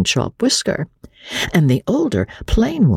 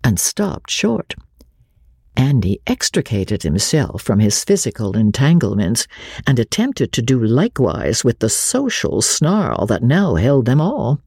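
usual speaking voice

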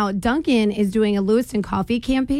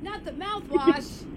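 soft, slow mock dismay; mock horror; 6 semitones higher, 8 dB quieter, slower than usual. Real, not acted. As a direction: gasp it out in fake shock. Mock horror at caller's rap.

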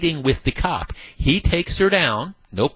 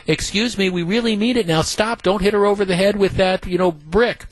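higher, prissy mock-polite pleading; mock de-escalation plea; 6 semitones higher than usual. Radio host mocking school staff's de-escalation, higher mock-polite plea. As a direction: play a feeble staffer begging kids to stop. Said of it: "give me the idea of these de-escalation tactics".

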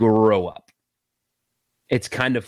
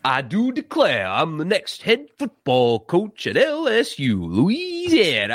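slow, higher cartoon rooster drawl, stretching Louisiana; Foghorn Leghorn; 4 semitones higher, slower than usual. Host spoofing the new coach's intro, slow cartoon Southern drawl. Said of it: "He's like Foghorn Leghorn".

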